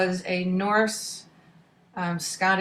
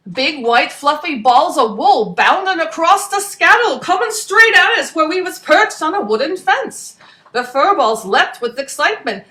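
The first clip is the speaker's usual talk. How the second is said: louder, brisk storyteller voice; lively story narration; 7 semitones higher, 9 dB louder than usual. A narrator's reading. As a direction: tell the adventure with gusto.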